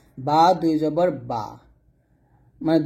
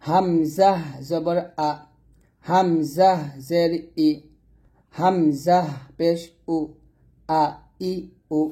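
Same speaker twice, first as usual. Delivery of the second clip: slow, spelled-out vowel drill; teacher's slow letter-by-letter recitation; slower than usual. Shown as a demonstration. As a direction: read each syllable slowly for beginners. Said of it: "এরকমভাবে উচ্চারণ করবেন".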